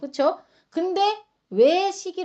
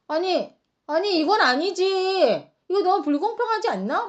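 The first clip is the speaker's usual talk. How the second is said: mock indignant complaint; 6 semitones higher than usual. First workers voiced complaining.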